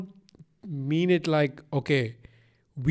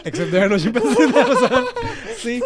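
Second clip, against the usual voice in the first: higher, louder, fast through laughter; laughing admission; 8 semitones higher, 6 dB louder than usual. Real, not acted. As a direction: laugh while owning up to the blunder. Corrects himself after being caught out.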